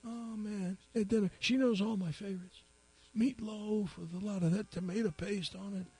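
put-on high, teary old man voice. Crying old man tells the policeman his news, high and weepy. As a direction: play a weepy old timer. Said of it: "He says".

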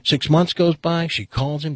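ordinary delivery

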